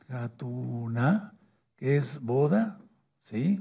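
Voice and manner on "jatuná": "Jatuná" muy lento, con sílabas alargadas; dictado silabeado. Shown as a demonstration. Repite la palabra para apuntarla. ("Jatuná" despacio, como para que lo copien)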